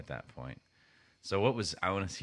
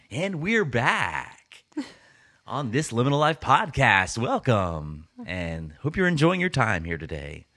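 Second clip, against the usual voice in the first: higher, louder radio DJ delivery; 7 semitones higher, 9 dB louder than usual. Shown as a demonstration. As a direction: boom it out like a radio DJ. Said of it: "Trying not to do that voice".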